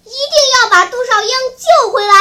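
loud, high, fast rallying cry; determined yell; shouting. A narrator's reading. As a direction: shout it out with fierce resolve. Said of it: "在院子里喊叫着".

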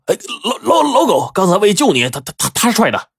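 nervous high-pitched stutter; flustered, repeating syllables; stammering excuse. A narrator's reading. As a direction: stumble over the words while shifting the blame. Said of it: "支支吾吾的".

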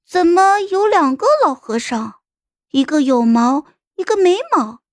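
put-on high, puzzled young girl's voice; baffled little one piping up with a question; puzzled child. A narrator's reading. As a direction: ask it in a high, innocent child's pitch. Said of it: "疑惑的就问".

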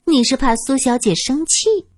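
gleeful, needling singsong; teasing young woman.

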